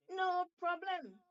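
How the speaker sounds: high, loud, slow shark voice; reassuring shark. Acted.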